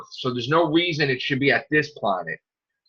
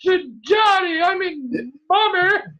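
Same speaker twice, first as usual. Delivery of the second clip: hammed-up cry; much slower than usual. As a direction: wail it like an overacted sob. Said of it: "mocking character in episode".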